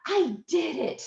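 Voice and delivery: high-pitched retold burst of triumph; excited. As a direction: squeal it a little, thrilled with yourself. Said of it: "So I'm so excited".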